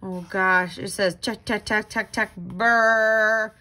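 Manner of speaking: usual speaking voice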